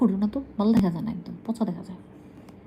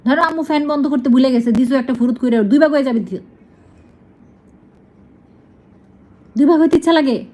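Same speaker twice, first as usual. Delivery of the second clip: loud warning scold; 2 semitones higher, 5 dB louder, faster than usual. Real, not acted. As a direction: warn it off sharply. Scolding bird about fan danger.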